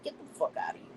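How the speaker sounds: a whispered curse under the breath; whispered aside. Real, not acted. Aside dismissing a chat comment.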